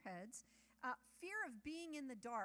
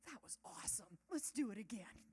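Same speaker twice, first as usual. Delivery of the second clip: breathless relieved excitement; 4 dB louder than usual. Retold or imitated. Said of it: "I take a deep breath".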